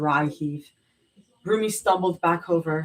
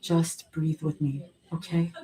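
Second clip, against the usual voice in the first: steady her gently; quiet soothing; calm reassurance; 4 dB quieter than usual. A narrator's reading. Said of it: "voice trembling but steady".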